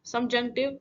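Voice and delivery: ordinary talk, nothing marked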